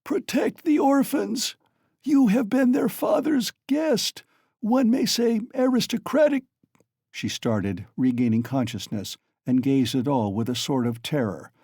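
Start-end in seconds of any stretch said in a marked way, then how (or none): 0.0-6.5 mother begging for her orphans; tearful pleading. A narrator's reading. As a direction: beg through tears. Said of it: "heart-rending scream and a flood of tears".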